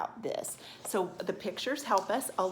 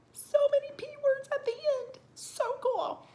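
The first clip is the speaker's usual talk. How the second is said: high, sing-out delight held on one pitch; excited cheer. Real, not acted. Sung-out, high delighted cheer to class.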